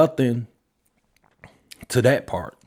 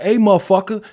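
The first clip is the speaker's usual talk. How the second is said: higher and louder, a sudden call-out; mock yell; 7 semitones higher, 6 dB louder than usual. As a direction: snap at the guy who cut in line. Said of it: "you can be like".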